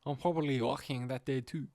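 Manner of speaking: put-on a light French accent on the line; lightly voiced French accent; mild French accent. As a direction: say it with a hint of French. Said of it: "it's a French accent".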